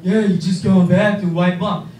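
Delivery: low, loud imitation of a brother; brother impression voice. Brother explains the baby wipe trick, low-voiced. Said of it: "He's like".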